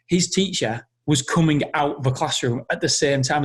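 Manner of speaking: ordinary delivery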